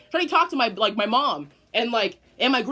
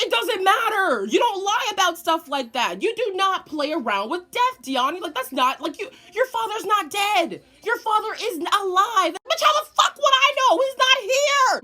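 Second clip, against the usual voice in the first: higher, mock-angry lecture; scolding grandma; 10 semitones higher, 5 dB louder than usual. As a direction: scold the kid, getting angrier. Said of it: "She was like".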